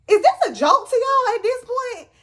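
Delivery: high, wailing mock indignation; mock tearful protest. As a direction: whine it as if crying and hurt. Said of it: "crying still, but looking confused".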